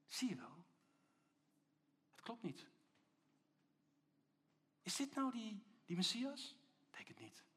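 half-whispered, doubting little voice; a soft, insinuating murmur of doubt; nagging inner voice. Acted. Little voice sowing doubt in imprisoned Johannes, half-whispered. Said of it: "een stemmetje die tegen hem zegt".

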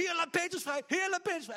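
high, loud, pleading chant; fervent loud prayer. Retold or imitated. The church ignoring Rhoda and praying loud and high for Peter. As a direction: cry out to God again and again.

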